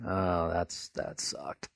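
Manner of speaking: low, deflated disciple voice; mock depressed. Disciples giving up after the death, low mock-depressed voice. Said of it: "they're just depressed".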